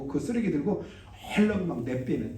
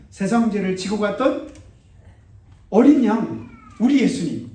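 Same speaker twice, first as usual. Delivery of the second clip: high, loud, impassioned sermon; full-force preaching; 7 semitones higher, 9 dB louder than usual. Acted. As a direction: drive the warning home to the congregation.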